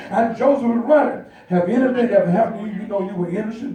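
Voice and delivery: ordinary delivery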